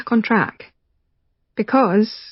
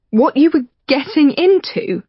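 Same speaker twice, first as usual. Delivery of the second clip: higher, incredulous echo; Rachel hurt; 7 semitones higher, 3 dB louder than usual. Acted. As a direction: throw his words back, high. Rachel stung by his remark.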